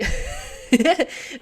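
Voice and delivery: loud, high laugh breaking out; high burst of laughter. Real, not acted. Laughing at own silly singing, loud high laugh.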